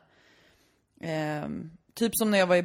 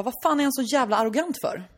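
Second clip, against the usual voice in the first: angry father; 6 semitones higher, 3 dB louder than usual. Retold or imitated. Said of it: "säger pappan".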